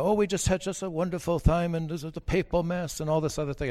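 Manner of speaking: put-on a mild Australian accent, enthusing about the papal mass. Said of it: "I could tell this Australian accent".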